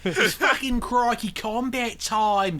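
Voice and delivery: slow, hammed-up Australian announcer; mock Australian accent. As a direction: drawl it out like a cartoon Aussie. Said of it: "This Australian accent is choking me".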